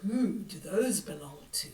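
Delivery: hushed, spooky picture-book reading; reading to children. A narrator's reading. Granny reading a spooky book to children, hushed. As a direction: draw the kids into the mystery.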